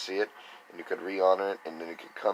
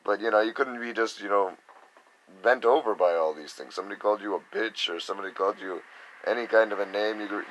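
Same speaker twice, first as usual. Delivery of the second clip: ordinary talk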